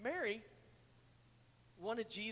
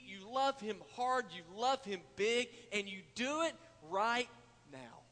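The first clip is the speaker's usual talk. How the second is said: loud, driving sermon voice, often slowed; preaching at full force; 4 semitones higher, 6 dB louder than usual. Acted. Preacher urging the congregation to love God now, full force. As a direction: press it home to the congregation.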